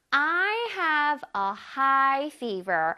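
very slow, careful English; slow teacher demo; slow model reading. Shown as a demonstration. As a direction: read each word slowly for students. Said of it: "문장에서 보겠습니다".

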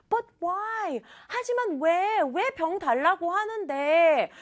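high, slowed bewildered questioning; dumbfounded. Acted. Boy asking why she wants the bottle, acting dumbfounded. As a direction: protest in acted disbelief. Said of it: "막 황당해하면서".

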